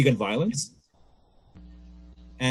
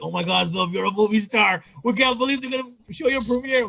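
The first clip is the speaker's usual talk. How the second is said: higher and rising, giddy; excited friend; 8 semitones higher than usual. Retold or imitated. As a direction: gush like he can't believe it. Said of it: "He's like".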